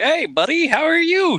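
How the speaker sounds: loud high cheery yell. Retold or imitated. Gamer imitating a wagon driver blocking the bridge. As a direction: shout it like an overfriendly stranger. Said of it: "this guy on a wagon was like".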